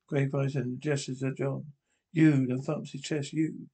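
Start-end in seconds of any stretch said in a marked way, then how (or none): none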